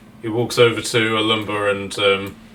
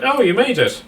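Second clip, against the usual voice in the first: much higher, bright mock surprise; Scott's greeting; 12 semitones higher, 5 dB louder than usual. Scott arriving to greet the party, high mock surprise. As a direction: greet them as if amazed they survived, high and loud.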